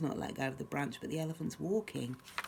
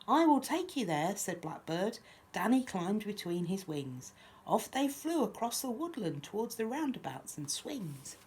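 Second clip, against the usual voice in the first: lively, lilting picture-book reading; children's story narration; 4 semitones higher, 3 dB louder than usual. A narrator's reading. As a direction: read a rhyme aloud to little ones. Reads picture book aloud.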